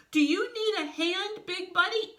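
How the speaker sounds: high, loud cartoon call; urgent call. Urgent offer during the fall.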